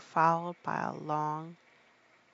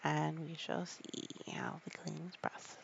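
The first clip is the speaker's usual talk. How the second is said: whispered aside, very soft; 3 semitones lower, 12 dB quieter than usual. Real, not acted. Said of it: "parent whispering to sleepy child".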